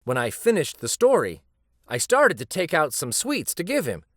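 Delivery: ordinary delivery, nothing marked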